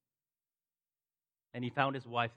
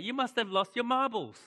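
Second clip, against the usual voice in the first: higher, louder, incredulous friends teasing; mocking friends; 10 semitones higher, 6 dB louder than usual. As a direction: scoff at him like he's lost his marbles. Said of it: "His friends must be like".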